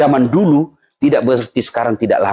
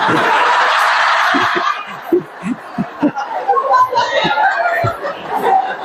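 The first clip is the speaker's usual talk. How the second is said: high burst of laughter. Real, not acted. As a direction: laugh hard at the punchline. Laughing at his own joke. (tertawa terbahak-bahak)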